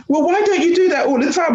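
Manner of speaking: high-pitched mock wonder; impressed line manager. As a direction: imitate an astonished boss, voice well up. Manager's eager question retold.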